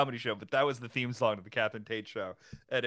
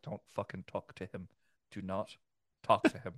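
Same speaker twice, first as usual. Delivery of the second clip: much softer, urgent under-the-breath order; hushed warning; 6 semitones lower, 6 dB quieter than usual. Retold or imitated. Aunt quietly warning grandpa in a tense bar. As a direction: keep it low so the bar can't hear.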